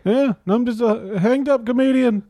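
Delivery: high-pitched hack stand-up comic; wisecracking crucified Jesus. Acted. Dying Jesus justifies joking to visitor, high-pitched wisecracking. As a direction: plead your case like a struggling comedian. Plays Jesus as aspiring comedian.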